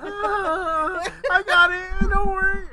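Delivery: panicked high pitched reassurance; cracking high squeak; squeaky high voice. Retold or imitated. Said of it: "My voice keeps going higher and higher".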